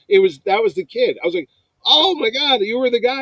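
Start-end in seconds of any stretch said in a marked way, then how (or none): 1.9-3.2 high-pitched, loud amazement; retold excited disbelief; astonished recognition. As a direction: exclaim it as the realization hits. Retold sudden recognition of kid.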